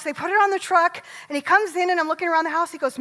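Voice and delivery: normal talking voice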